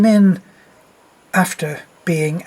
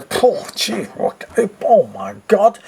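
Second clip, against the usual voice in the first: mock disgusted groans; 4 semitones higher than usual. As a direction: act out people recoiling from a stench. Disgusted moviegoers reacting to smell.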